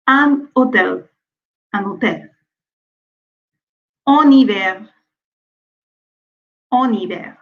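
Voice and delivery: un hôtel as u-n-hôtel, en hiver as a-n-hiver; liaison before mute h. Shown as a demonstration. Teacher drilling liaison before silent h. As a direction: carry the n into the next word. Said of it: "on fait la liaison".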